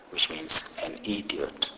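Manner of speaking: ordinary delivery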